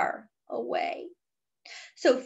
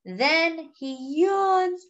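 usual speaking voice

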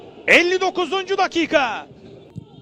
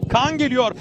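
ordinary talk

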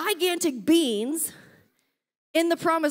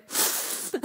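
breathy disgusted gasp; 6 dB quieter than usual. Retold or imitated. Reaction to stinky vapor.

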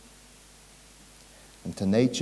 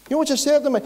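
high, amazed lead-in; incredulous rhetorical question; 14 semitones higher, 4 dB louder, much faster than usual. Real, not acted. Preacher asking the congregation what she said, incredulous. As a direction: raise it up as if still astonished. Building up to her reply.

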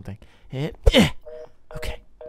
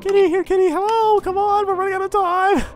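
high, loud frantic call to the cat; 10 dB louder than usual. Real, not acted. Streamer calling the kitty while fleeing, high and loud.

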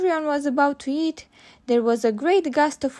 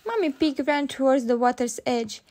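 usual speaking voice

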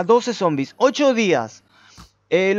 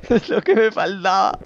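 normal talking voice

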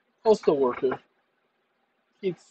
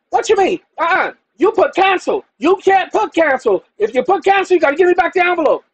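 higher, louder clerk voice, speeding up at the end; mock demanding clerk; 9 semitones higher, 4 dB louder than usual. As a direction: badger him like the clerk. Clerk demanding envelope, retold.

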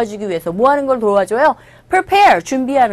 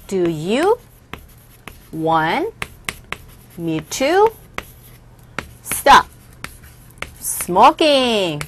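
very slow, long pauses between words; separated words, spaced out for learners; 3 semitones lower, much slower than usual. Shown as a demonstration. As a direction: dictate it one chunk at a time. Breaks example down word by word.

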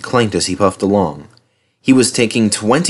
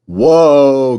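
loud, drawn-out, higher call; calling a halt; 4 semitones higher, 5 dB louder than usual. A narrator's reading. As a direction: rein in a big engine with your voice. Driver calls engine to halt.

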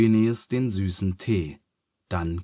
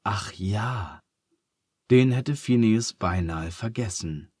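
usual speaking voice

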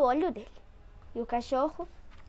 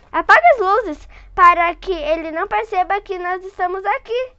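plain reading voice

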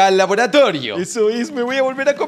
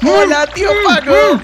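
high, loud, mocking hello; gleeful taunting greeting; 8 semitones higher, 6 dB louder than usual. Greeting Paco, who is kidnapped in the laboratory, gleeful taunt. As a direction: crow a hello at your prisoner.

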